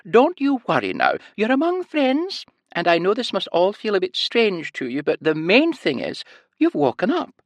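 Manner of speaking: high sing-song reassuring Scottish lilt; Scottish nurse voice. A narrator's reading. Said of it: "a heavy Scottish accent and a high-pitched sing-song voice".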